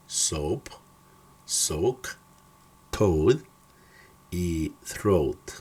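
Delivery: careful English pronunciation of "boat", "coach", "goal"; English O words. Shown as a demonstration. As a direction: say "boat", "road", "soap" slowly with a long English O. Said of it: "Se dice".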